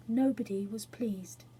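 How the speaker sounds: plain reading voice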